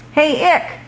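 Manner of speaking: high calling shout across the field; dog yelling for his buddy. Acted. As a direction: call out to a distracted friend. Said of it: "yelled Crud".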